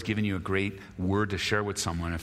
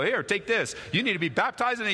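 mock angry baptizing; 10 semitones higher than usual. Dumping water cooler on opponent.

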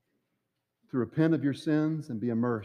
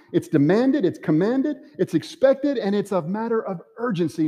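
louder and higher with each word; building preaching climax; 6 semitones higher, 5 dB louder than usual. Acted. Preacher drives home that evangelism is required, rising loud. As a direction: climb to a ringing peak on expected.

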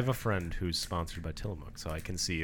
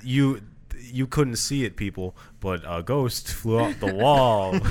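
mock astonished exclaim; 4 semitones higher, 8 dB louder, much slower than usual. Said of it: "anything where it's like".

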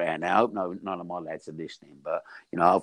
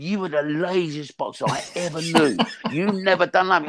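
higher, laughing through the jab; laughing mock jibe; 8 semitones higher than usual. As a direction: tease him while laughing, pitched up. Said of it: "He said".

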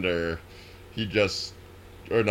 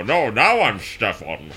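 a hint of Sean Connery; Sean Connery impression. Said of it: "slightly American Sean Connery".

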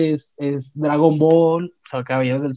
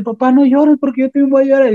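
put-on little girl's plea; 9 semitones higher, 4 dB louder than usual. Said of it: "la niña dice".

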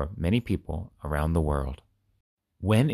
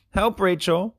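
high call for help; crying out for help; 12 semitones higher than usual. A narrator's reading. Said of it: "Chad cried".

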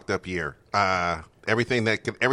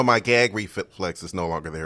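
normal talking voice

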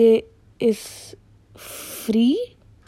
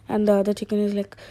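ordinary delivery

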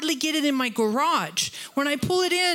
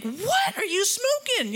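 much higher, slower, disbelieving; incredulous retort; 9 semitones higher than usual. Retold or imitated. Preacher retelling her retort to a cussing driver, disbelieving. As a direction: fire back in total disbelief. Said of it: "and I'm like".

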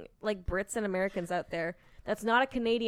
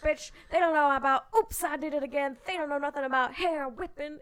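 high, loud preacher-style rant; Vanjie impression; 7 semitones higher, 5 dB louder than usual. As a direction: preach it like a drag queen sermon. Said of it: "Vanjie is the person doing the conversion".

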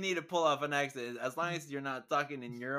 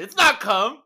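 loud, high outburst; defensive shout; 8 semitones higher, 13 dB louder than usual. Retold or imitated. Customer protesting to the cashier, defensive shout.

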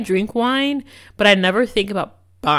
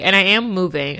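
ordinary talk, nothing marked

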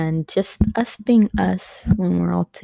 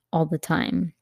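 ordinary delivery

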